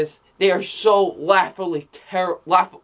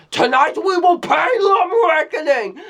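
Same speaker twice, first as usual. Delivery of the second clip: booming high mock-epic battle cry, hammed up; mock villain shout; 10 semitones higher than usual. Reviewer imitating the movie villain's over-the-top battle cry, hammed-up shout. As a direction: ham up the overwrought villain's rallying cry. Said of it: "He's like".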